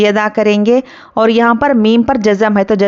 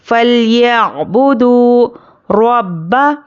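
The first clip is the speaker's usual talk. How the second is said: slow chanted tilawat; Quran recitation; much slower than usual. Shown as a demonstration. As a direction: recite slowly with long vowels. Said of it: "teacher recites". (धीमी तिलावत)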